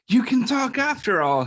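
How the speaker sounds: high, loud exclamation of amazement; crying out. A narrator's reading. Bear amazed the little voice can talk, crying out. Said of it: "he cried".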